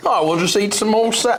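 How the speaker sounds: half-hearted mock Australian accent, trailing off; botched Australian accent impression; failed Australian accent. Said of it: "I can't do the Australian accent at all".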